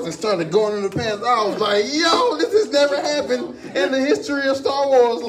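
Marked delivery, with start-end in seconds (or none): 1.9-5.2 raised-pitch mock astonishment; retold amazement. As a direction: act out your stunned reaction. Retelling his own amazed reaction.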